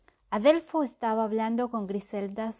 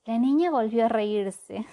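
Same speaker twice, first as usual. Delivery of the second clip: usual speaking voice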